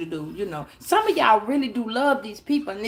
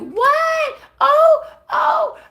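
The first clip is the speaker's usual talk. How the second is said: high, loud gasp of surprise; mock shocked shriek; 6 dB louder, much slower than usual. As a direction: shriek in fake astonishment. Acted shock at a proposal.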